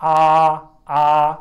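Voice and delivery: आ held long and level, mouth wide open; open-mouthed aah. Shown as a demonstration. Patient opening up for the doctor's throat check, long open आ. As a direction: say आ as if showing a doctor your throat. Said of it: "आप ज़रा मुंह खोलें".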